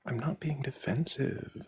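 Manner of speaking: high, whiny mock of his denial. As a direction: parrot him in a high, whiny voice. Mocking the other's denial.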